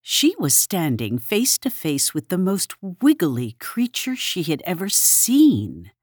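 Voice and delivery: usual speaking voice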